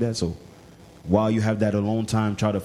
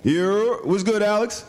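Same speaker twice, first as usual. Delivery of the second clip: high, loud hype hello; pumped-up phone greeting; excited greeting; 12 semitones higher, 3 dB louder, much slower than usual. Real, not acted. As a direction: greet your friend big on the phone.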